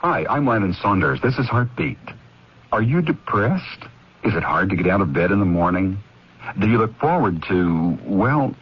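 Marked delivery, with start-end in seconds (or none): none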